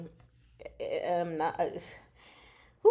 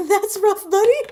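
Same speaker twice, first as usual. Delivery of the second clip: loud, high cartoon quote of that's rough buddy; quoted catchphrase; 13 semitones higher, 13 dB louder than usual. Quoting a commenter's meme line back, loud and high. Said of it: "if you're just saying it that way or if I'm picking up your exact reference".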